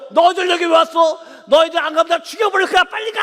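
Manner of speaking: loud, fast, high threatening yell; demonic threat. Retold or imitated. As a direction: hurl it at them, ending in a high shout. Sister shouts at them possessed.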